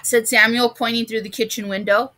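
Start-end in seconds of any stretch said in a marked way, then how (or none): none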